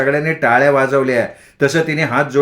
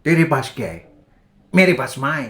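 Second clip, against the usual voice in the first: Amitabh dialogue. Retold or imitated. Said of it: "अमिताभने असा dialogue म्हटला".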